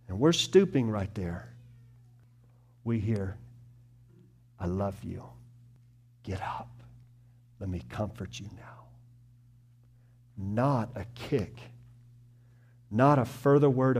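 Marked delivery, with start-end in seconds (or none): 4.6-8.7 soft, gentle and much quieter; hushed, tender reassurance; tender comfort. Acted. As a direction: speak like lifting someone off the floor. Voicing Jesus comforting the stooping.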